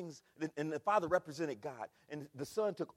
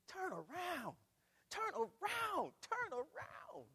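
pleading call; 12 semitones higher, 4 dB quieter, much slower than usual. Acted. Jesus calling, screaming.

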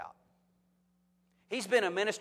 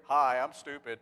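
a touch of a sheepish group member introducing himself; lightly mocking self-introduction at a support group; mock support group confession; 3 semitones lower, 5 dB louder than usual. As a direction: own up like it's a support group meeting. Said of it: "we got another support group going here".

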